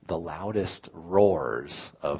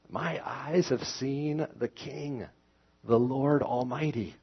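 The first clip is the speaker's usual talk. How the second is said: anguished lament; 7 semitones higher than usual. Acted. Reading Isaiah's anguished confession aloud.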